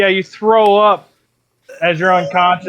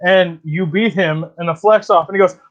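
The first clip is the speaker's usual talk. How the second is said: usual speaking voice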